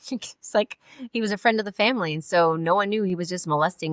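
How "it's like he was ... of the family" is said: nervous-sounding laugh into higher-pitched words; real, uneasy chuckle while talking. Real, not acted. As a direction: laugh through it though it's not funny. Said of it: "I'm laughing".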